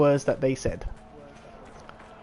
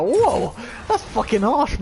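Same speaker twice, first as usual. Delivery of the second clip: shocked whoa; 6 semitones higher, slower than usual. Retold or imitated. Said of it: "I was like".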